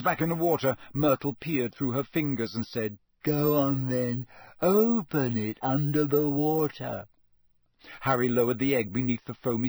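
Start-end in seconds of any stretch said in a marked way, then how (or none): 3.2-7.1 put-on slow, drawn-out glum ghost girl.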